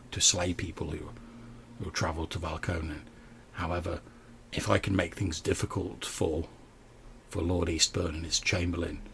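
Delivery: low, quiet in-character voice; measured bandit leader. Acted. Bandit leader laying out terms for wagon trains, measured and quiet. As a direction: speak low and soft, stating your terms.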